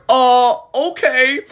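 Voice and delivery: a baffled high okay; confused okay. Retold or imitated. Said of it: "And I'm like".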